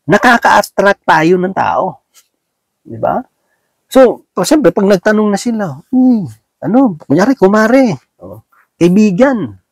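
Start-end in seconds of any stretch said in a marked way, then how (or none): 5.9-9.6 a touch higher, lightly voiced friend calling out; mild eager hello from a churchgoer; friendly asking voice. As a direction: call over to an auntie, a little higher and chattier. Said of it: "may magtatanong siya".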